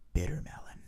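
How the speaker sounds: softly, like a secret; soft secretive. Retold or imitated. Clerk confiding his cure to the diabetic, softly. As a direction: whisper it like Fort Knox codes. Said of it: "He said it, like, really softly".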